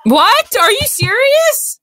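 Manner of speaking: high shrill disbelief; shocked. Acted. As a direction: gasp it out, way up high. Shocked merengue is gone.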